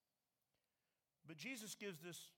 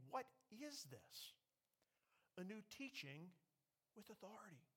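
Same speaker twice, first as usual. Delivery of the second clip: amazed; 2 semitones higher, 7 dB quieter than usual. Acted. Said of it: "and they were all amazed".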